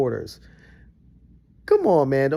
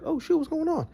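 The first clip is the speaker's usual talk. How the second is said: lightly voiced puzzled dog, higher; a confused pup, pitched up; dog's thoughts; 13 semitones higher than usual. Voicing his dog staring at the TV. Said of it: "His head was like".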